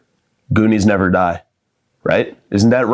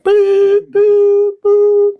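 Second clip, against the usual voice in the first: very high loud beeps; heart monitor beep; 4 dB louder, much slower than usual. Retold or imitated.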